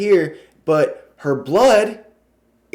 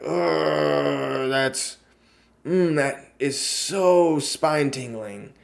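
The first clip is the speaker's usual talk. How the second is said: softer, slow shiver of disgust; creeped-out shudder; 5 dB quieter, much slower than usual. Real, not acted. Vlogger reacting to the eerie ad, softer and slow. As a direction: savour the chill in the words. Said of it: "And it's like".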